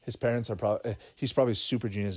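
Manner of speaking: ordinary delivery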